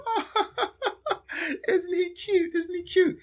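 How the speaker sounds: high, fluttery falsetto with a laugh; mock rich lady. Rich people cooing at the activist, high mock falsetto. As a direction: coo it like a condescending rich socialite.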